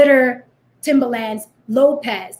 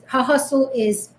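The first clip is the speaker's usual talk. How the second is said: ordinary talk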